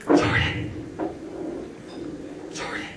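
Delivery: whisper-calling Jordan. Retold or imitated. Hiding in the school, whisper-calling for his friend. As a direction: call out in a hush so no one hears.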